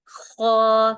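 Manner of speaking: خو loud, scraped from the upper throat; throaty kho. Shown as a demonstration. Teacher showing learners the scratchy خو, loud. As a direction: rasp خو out from the top of the throat. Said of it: "جیسے خراش سی ہوتی ہے ہمارے گلے میں".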